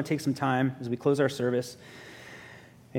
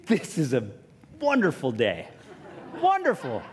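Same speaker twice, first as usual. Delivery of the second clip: gleeful; 2 semitones higher than usual. Retold or imitated. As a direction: squeal it with glee. Delighted at the cash.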